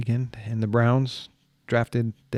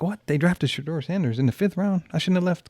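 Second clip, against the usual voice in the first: surprised; 6 semitones higher than usual. Retold or imitated. Retells shock at draft news.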